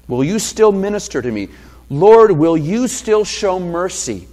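lightly voiced desperate mother pleading; pleading woman. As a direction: beg for any crumb from the table. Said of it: "She essentially admits".

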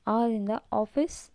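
ordinary delivery

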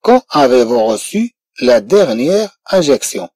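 very slow French question; slow model sentence. Shown as a demonstration. Teacher reading the example question, slowly. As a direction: read it word by word.

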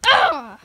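loud mock battle cry; battle yell. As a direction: yell as you charge in. Battle yell before attack.

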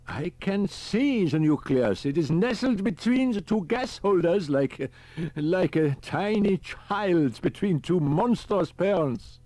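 put-on high, slow, dreamy old professor; almost whimsical. A narrator's reading. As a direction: muse over it with odd delight. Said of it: "He sounded almost whimsical".

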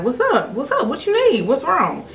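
put-on boyfriend's voice. Boyfriend asking what she needs, eagerly. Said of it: "And he was like".

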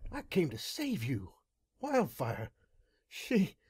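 high, choked, breaking voice; choking up while pleading with a brother; words catching in throat. A narrator's reading. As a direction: struggle to get the words out. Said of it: "the words caught in my throat".

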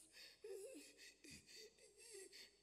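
high, thin giggling laugh; soft high-pitched helpless laughter; re-enacted laughing. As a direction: laugh in squeals under the breath. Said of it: "I started laughing".

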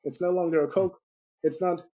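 ordinary delivery

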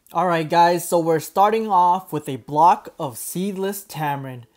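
soft, quiet tutorial voiceover; calm voiceover narration. Acted. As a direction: narrate the steps calmly and quietly.

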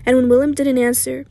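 ordinary delivery

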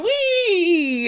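high, squealing playground whee; gleeful child. Retold or imitated. Vlogger mocking wrestler as a playground child, high gleeful squeal. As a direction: squeal it like a kid on a swing. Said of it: "like a child in the playground".

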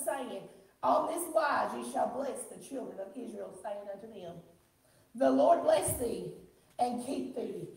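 measured, projected pulpit reading; solemn, drawn-out proclamation; scripture reading. Real, not acted. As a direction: declare the blessing over the congregation. Reads scripture over congregation.

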